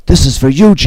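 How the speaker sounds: high, loud battle cry; vengeful shout. Acted. Cha-Cha avenges Yuji on Yasha, vengeful shout. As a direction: shout it out high as a final blow.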